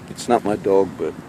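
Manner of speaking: ordinary talk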